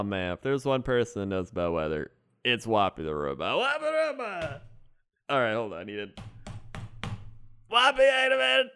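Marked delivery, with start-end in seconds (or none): 3.6-4.6 high synthetic swooping robot cry. Acted. Robot character announces itself. 7.7-8.7 high, loud mechanical monotone; robot voice. Acted. Robot character starts up.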